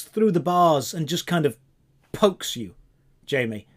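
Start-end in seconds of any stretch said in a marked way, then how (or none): none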